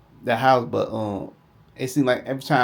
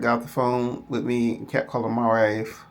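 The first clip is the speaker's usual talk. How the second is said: ordinary talk, nothing marked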